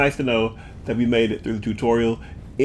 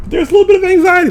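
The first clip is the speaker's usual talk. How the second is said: high, fast nervous squeal; anxious squeal; 8 dB louder, faster than usual. Acted. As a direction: squeak it out in a panic. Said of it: "anxiety right here".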